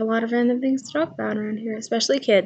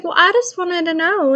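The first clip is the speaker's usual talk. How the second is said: put-on child voice; 8 semitones higher, 4 dB louder than usual. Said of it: "He's like".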